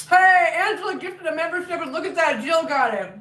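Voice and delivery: bright, loud, excited announcement; excited shoutout. Real, not acted. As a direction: call it out with delight. Excited shoutout for gifted membership.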